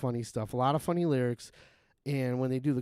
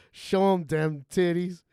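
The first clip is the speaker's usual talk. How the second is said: slow, louder imitated line; loud quote; 5 semitones higher, 6 dB louder, slower than usual. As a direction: belt it out slowly. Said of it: "and he's like".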